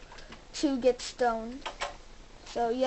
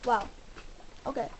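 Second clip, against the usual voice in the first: ordinary talk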